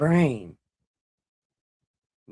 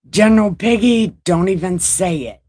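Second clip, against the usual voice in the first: loud, high-pitched warning; raised-voice telling-off; 5 semitones higher, 8 dB louder than usual. Real, not acted. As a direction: cut someone off loudly before they speak. Teasing warning to a viewer.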